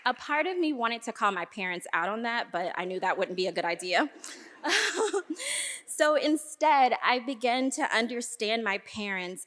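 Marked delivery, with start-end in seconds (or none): 4.6-5.8 short, high chuckle; amused laugh. Real, not acted. Speaker joking about calling her parents out, amused chuckle. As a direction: laugh lightly at your own joke.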